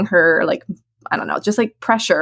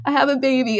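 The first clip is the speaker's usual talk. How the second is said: tearful awe; 11 semitones higher, 3 dB quieter than usual. Retold or imitated. First hearing her baby cry.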